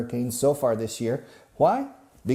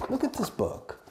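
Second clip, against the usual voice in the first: silly high voice; 4 dB quieter than usual. Shown as a demonstration. Repeats line in silly voice.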